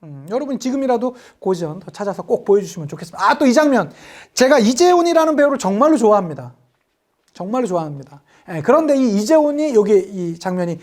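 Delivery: ordinary talk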